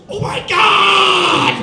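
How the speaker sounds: long, high, very loud holler; mock shocked scream. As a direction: scream it out, stretching the last word. Acts out shock at the duck.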